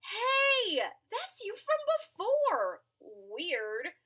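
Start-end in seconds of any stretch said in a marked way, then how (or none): 0.0-2.8 surprised. Acted.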